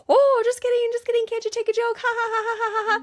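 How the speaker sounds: tiny goblin in a high voice forcing a ha-ha laugh; nervous goblin backpedal. Retold or imitated. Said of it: "And he's like".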